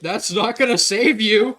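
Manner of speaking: loud protest. Real, not acted. Player objects to teammate's escape plan, loud protest. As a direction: call out the objection, stretching it.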